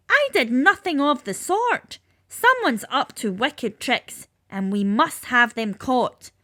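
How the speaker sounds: stamp your foot and vow to catch them; indignant Tooth Fairy. Acted. Tooth Fairy insisting to the dentists she left no sweets, indignant.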